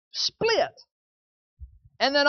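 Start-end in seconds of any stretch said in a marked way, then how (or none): 0.1-0.8 a light, higher commanding word from God; God's command. Pretend. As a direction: speak it as the Father's single decisive order, lightly. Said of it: "all the Father has to say to that atom is".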